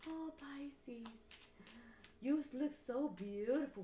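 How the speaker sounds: high, fawning voice of a begging ex; mock pleading ex. Ex begging Pisces to come back, high fawning plea. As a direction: gush over Pisces like a desperate ex.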